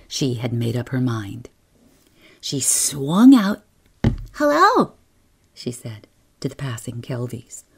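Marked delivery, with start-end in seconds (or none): 4.3-4.9 put-on high, loud child's hello; calling out. Kezia hails the passing Kelvies, calling out. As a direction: call out brightly to passers-by.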